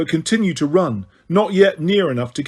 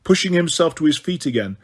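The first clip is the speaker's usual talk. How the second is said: ordinary delivery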